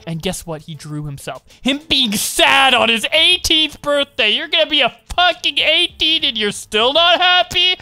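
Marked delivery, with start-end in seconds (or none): 1.6-7.8 loud, high, outraged shouting; angry rant. Acted. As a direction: read it as a ranting all-caps tirade.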